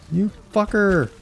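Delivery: high-pitched slow furious yell; real outrage at the game; angry outburst. Real, not acted. Reacting to the loss in game.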